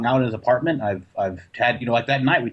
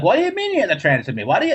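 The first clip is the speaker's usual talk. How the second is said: higher, indignant protest; arguing caller; 9 semitones higher, faster than usual. Retold or imitated. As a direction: push back like an irritated caller. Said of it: "fucking arguing with me".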